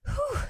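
a high, loud sigh of relief; relieved sigh. Acted. Prince sighing with relief to the giants. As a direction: let out a big relieved whew. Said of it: "sighed the prince".

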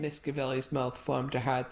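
plain reading voice

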